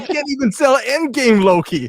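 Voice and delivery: high, loud comic complaint; mock exasperated wail. Toy maker who can't sell Endgame Loki, mock exasperation.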